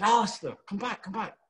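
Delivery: high-pitched imitation of anxious calling; urgent calling back. Young man runs after the departing actor, imitated urgent calling. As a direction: call after someone, pitched well up.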